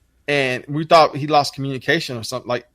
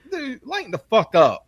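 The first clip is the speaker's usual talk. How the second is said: higher squeaky complaint; mock whiny kid; 8 semitones higher than usual. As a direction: sneer it in a squeak. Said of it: "I'm like".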